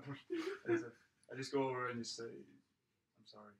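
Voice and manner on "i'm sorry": very slow, soft sorry; hushed apology. Acted. Player going over before leaving, slow hushed apology. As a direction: draw the apology out under the breath.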